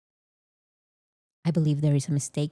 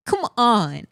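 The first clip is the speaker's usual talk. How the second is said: pleading come on; 4 semitones higher, 3 dB louder than usual. Real, not acted.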